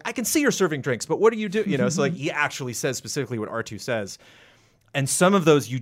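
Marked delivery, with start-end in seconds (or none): 0.0-1.7 high, fussy mock robot voice; prissy protocol droid. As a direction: play the startled butler robot. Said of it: "And he's like".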